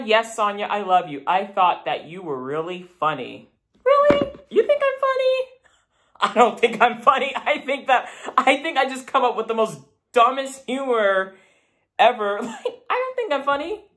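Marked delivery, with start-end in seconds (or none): 3.8-5.3 squeaky high disbelieving "really?"; mock surprise. Reacting to a viewer compliment. 12.4-13.8 laughing. Real, not acted. Laughing after the compliment.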